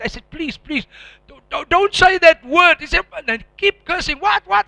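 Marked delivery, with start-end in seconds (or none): 0.3-2.8 loud, high, slow begging; desperate plea. Retold or imitated. Pleading with his friend to stop cursing, loud and desperate. 4.2-4.7 high, loud mock innocence; taunting friend. Friend taunting him with more curses, high and mocking.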